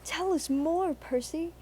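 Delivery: high, eager plea; eager engines. A narrator's reading. The engines urge Percy on, high and eager. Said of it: "said the engines".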